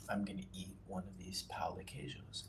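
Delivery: soft unvoiced aside; whispering. Real, not acted. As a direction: whisper it to yourself. Aside before eating.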